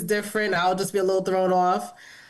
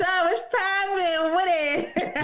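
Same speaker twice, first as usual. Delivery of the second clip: higher, drawn-out hype greeting; playful high greeting; 8 semitones higher, much slower than usual. Pretend. Hyping a friend's new body with a high greeting. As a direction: stretch it out like a hype man.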